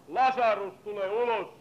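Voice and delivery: "Lasarus, tule ulos" called out loud and high like a command to the grave. Acted. Preacher acting Jesus' command, loud call. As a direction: call Lazarus out of the tomb, loud. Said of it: "Nyt tarvittaisiin sellainen sana".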